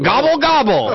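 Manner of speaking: mock turkey gobble, rising high. Imitating a turkey, laughing.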